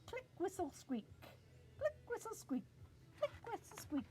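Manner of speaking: high dolphin clicks and squeaks; dolphin talk. Pretend. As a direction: chirp it like a dolphin. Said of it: "It's more like".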